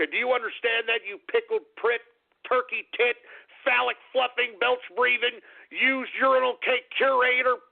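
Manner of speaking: comic tirade rising to a furious scream; building insult rant. Acted.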